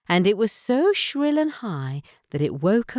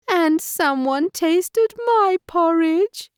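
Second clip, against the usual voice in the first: much higher, louder, slow squeaky cub; baby bear crying over his porridge, squeaky; high-pitched baby bear cry; 4 dB louder, slower than usual. A narrator's reading. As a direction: wail it like a tiny upset cub. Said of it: "cried Baby Bear in his high-pitched voice".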